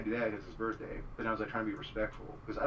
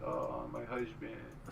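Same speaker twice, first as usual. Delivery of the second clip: lightly voiced doting girlfriend, trailing off softer; a hint of a girlfriend's fond gushing, dropping away; imitating girlfriend; 5 dB quieter than usual. Girlfriend calling him husband around her parents, lightly mimicked. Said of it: "she's always like".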